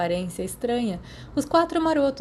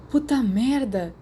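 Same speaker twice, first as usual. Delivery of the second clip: choked gasp; 4 semitones higher, slower than usual. A narrator's reading. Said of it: "James engasgou".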